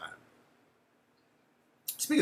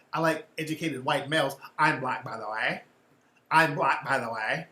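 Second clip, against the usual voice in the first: put-on Valley Girl voice. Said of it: "She's trying to sound like a Valley Girl".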